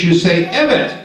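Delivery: evet, clear EH-vet; pronouncing evet. Shown as a demonstration. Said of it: "yes, you in Turkish".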